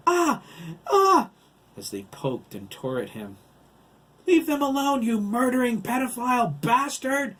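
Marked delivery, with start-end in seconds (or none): 0.1-1.3 two high, loud shrieks; screams of pain. A narrator's reading. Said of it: "He screamed". 4.3-7.4 high, loud enraged shriek; furious scream. A narrator's reading. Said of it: "Susan screamed".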